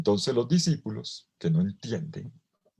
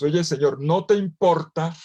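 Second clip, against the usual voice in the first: exasperated Marta; 6 semitones higher, 6 dB louder than usual. Retold or imitated. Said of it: "se le voló la piedra a la Marta".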